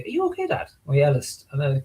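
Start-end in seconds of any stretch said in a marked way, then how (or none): 0.0-0.7 put-on very high, soft child's voice; concerned daughter. Daughter checking on Dad, high soft concern. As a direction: ask gently in a little girl's pitch. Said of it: "my daughter goes to me".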